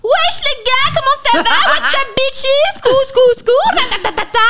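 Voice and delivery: high, loud imitation of a hyped influencer greeting; sweet melodious voice. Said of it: "ta douce, ta mélodieuse, ta délicate voix susurrée".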